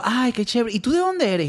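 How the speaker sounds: high-pitched chirpy acquaintance; girlfriend's friend. Retold or imitated. Girlfriend's friend asking where he's from, high mimicked voice. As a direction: pitch up like a curious new acquaintance. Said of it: "una reunión de unas amigas de mi".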